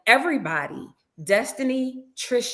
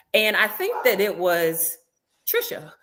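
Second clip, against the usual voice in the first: usual speaking voice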